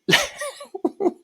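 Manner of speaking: a sudden high-pitched burst of laughter; high squealing laugh. Real, not acted. Vlogger cracking up at her story, squealing laugh.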